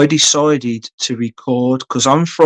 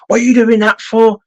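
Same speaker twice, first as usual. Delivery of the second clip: higher, mock-snapping jokey retort; a playfully sharp, higher "What are you doing that for?"; mock aggressive; 9 semitones higher than usual. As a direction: pretend to round on a mate, half joking. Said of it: "I turned round aggressively and I went".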